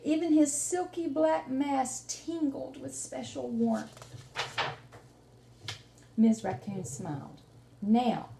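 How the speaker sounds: lively read-aloud storytelling; reading to children. Acted. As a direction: tell the story to a small child, warmly paced. Teacher reads picture book aloud.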